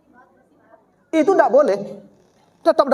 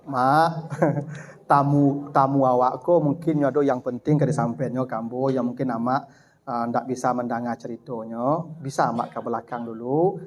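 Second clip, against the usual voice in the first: gentle polite request; 7 semitones lower, 5 dB quieter than usual. Acted. Child gently asking mother to leave.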